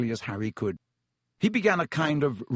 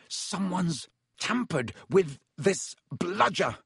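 slow, higher, strained grunt of effort; 5 semitones higher, slower than usual. Acted. Fred swinging at the rogue bludger, grunted. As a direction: force it out mid-swing. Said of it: "Fred grunted".

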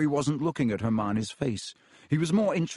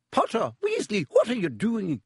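a hint of a sharp, higher teacher's challenge; stern teacher; 9 semitones higher than usual. McGonagall catching Harry and Ron in the corridor, sharp and higher. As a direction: catch them in the act, crisp and higher.

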